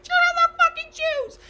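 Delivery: very high, loud screech; yelling mom. Retold or imitated. Mother screaming at kids about shoes. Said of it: "And you'd be like".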